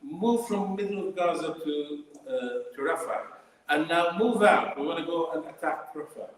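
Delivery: mocking quote. Campaigner mocking Israel's orders to Gaza civilians, sarcastic. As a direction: throw the orders back with scorn. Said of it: "The simple sort of".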